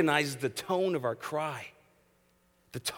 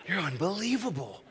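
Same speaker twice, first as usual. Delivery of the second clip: higher, laughing amazement; amused, admiring laugh; laughing disbelief; 6 semitones higher than usual. Real, not acted. Husband amazed at wife, laughing.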